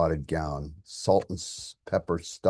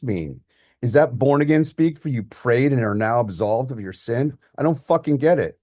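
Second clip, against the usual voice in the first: higher and louder, outraged; angry yelling; 5 semitones higher, 7 dB louder than usual. Acted. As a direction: rant in furious disbelief. Son keeps yelling at father.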